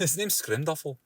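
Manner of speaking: hearty Scottish name announcement; slow Scottish barbarian introduction; Scottish accent. Acted. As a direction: say it with a Scottish burr. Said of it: "gotta say in a Scottish accent".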